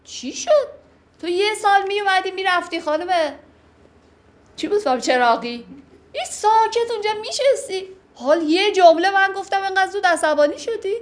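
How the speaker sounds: high, astonished and reproachful; mock surprised scolding. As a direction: act stunned that she blew up so fast.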